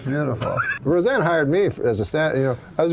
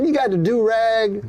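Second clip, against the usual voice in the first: loud put-on voice; 9 semitones higher, 5 dB louder than usual. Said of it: "Guy goes".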